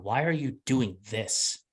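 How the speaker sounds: slowed, with "are" pushed hardest; stress on are. Shown as a demonstration. Showing the host's emphasis, slow with "are" stressed. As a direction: say "Why are you doing this?" leaning on "are". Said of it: "it was the emphasis on".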